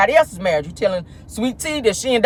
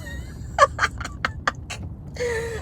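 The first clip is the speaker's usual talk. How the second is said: high laugh; 9 dB quieter than usual. Real, not acted. Laughing at Keke's rambling.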